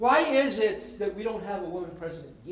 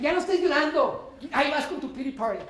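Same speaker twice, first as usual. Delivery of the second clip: higher and louder, mock scolding; teasing scold; 7 semitones higher, 5 dB louder than usual. Father teasing crying daughter about her pity party. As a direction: tease her out of the tears.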